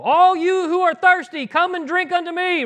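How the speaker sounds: high, loud proclamation over the crowd; shouting. Retold or imitated. Yeshua calling to the thirsty crowd, a loud high shout. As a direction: call out to thousands at the festival. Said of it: "you know, shouting it".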